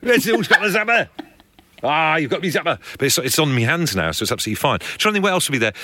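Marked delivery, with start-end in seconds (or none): 0.0-1.1 imitating a Geordie accent. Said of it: "which is just in a Geordie accent". 1.8-2.8 put-on Geordie accent. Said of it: "which is just in a Geordie accent".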